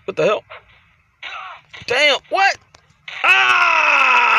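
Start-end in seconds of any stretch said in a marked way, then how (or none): 3.2-4.3 long, high "aah"; drawn-out yell, sliding down; pained scream. Real, not acted. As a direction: scream out as if taking the blow. His wrestler is hit hard.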